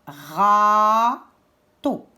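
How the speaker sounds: "Râteau" as a drawn-out râ, pause, teau; syllable by syllable. Shown as a demonstration. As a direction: sound out both syllables of "Râteau" separately. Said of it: "Il y a deux syllabes". (« Râteau » détaché en deux syllabes lentes)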